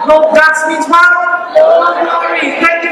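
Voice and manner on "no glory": "No glory" drawn out slowly and higher; slow final verdict. Acted. Closing punchline of sermon.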